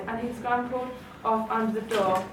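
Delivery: ordinary delivery, nothing marked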